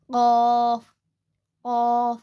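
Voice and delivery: क़ाफ़ heavy, q from the back of the tongue at the uvula; क़ाफ़: uvular q, full heavy a; Arabic qaf. Shown as a demonstration. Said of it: "इसको मोटा पढ़ेंगे".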